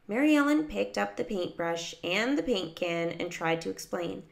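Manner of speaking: ordinary delivery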